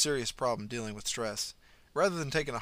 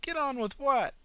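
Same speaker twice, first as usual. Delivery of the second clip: put-on high, sweet old lady voice; old woman; 4 dB louder, slower than usual. A narrator's reading. Old pensioner cheerfully greeting the ambulance officer, high and sweet. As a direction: chirp it like a kindly granny.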